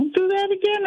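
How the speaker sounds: high-pitched mock scolding; imitating mad mom. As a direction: quote an annoyed mother, pitched well up. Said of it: "she got mad at me".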